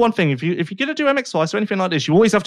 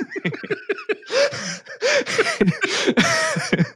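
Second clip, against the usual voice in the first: long high-pitched laugh; real laughter, high and breathless; high cackling laugh; 4 dB quieter than usual. Real, not acted. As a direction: burst into a squealing laugh. Laughing at the impression.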